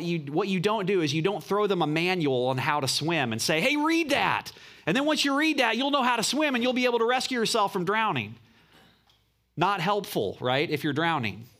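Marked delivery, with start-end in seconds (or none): 3.6-8.4 much higher, breezy mock helper; mock cheerful helper. Preacher mocking someone tossing a drowning man a manual, higher and breezy. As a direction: play the clueless helper, bright and higher.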